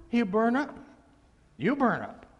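normal talking voice

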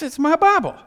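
loud, curt possessive snap; annoyed retort. Retold or imitated. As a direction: say it like keep your hands off. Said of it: "it's like".

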